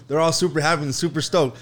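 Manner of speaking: ordinary delivery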